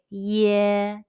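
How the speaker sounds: "ye" held long on a level note; teacher's model of a stretched "ye"; prolonged ye. Shown as a demonstration. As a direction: draw "ye" out with no E sound after it. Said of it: "but it's prolonged".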